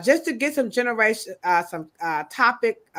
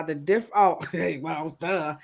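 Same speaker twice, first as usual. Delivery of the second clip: ordinary talk, nothing marked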